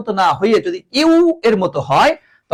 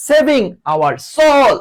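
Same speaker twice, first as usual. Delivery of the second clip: high, drawn-out cry for help; long, high SOS plea; acted distress call; 7 semitones higher, 3 dB louder, much slower than usual. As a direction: call out slowly as if in grave danger. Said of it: "কঠিন ভয়ঙ্কর বিপদে যখন পড়ে যায়".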